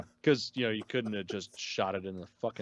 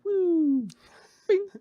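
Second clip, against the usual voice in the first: imitated ship sound; 7 dB louder than usual. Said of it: "That's pretty much the sound".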